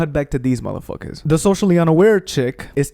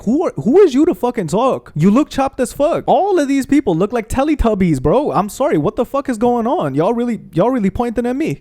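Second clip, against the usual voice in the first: outraged; 8 semitones higher than usual. Real, not acted. Outraged rant about mocking friends.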